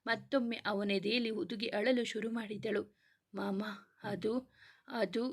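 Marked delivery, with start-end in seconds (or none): 3.3-5.3 slow, halting words through sobs; broken, tearful stammer; sobbing. Acted. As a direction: choke out the words between sobs. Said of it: "ಎಂದು ಬಿಕ್ಕಳಿಸಿದಳು".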